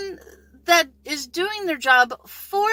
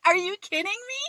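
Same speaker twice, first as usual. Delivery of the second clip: ordinary talk, nothing marked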